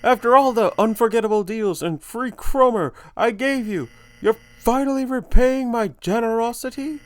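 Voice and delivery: high, theatrical showman voice swelling to triumph; exultant salesman puppet. Acted. Puppet announcing its comeback to Heaven, high, rapturous showmanship. Voices game character's dialogue.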